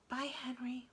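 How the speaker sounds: tender whispered goodbye; quiet farewell whisper; soft whisper. A narrator's reading. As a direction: breathe it after the departing creature. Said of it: "whispered Jack".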